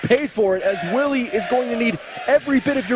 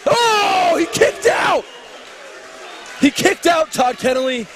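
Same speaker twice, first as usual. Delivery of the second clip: excited shouting; 4 semitones higher than usual. Real, not acted. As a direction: yell it as the crowd erupts. Kickout, commentator yelling.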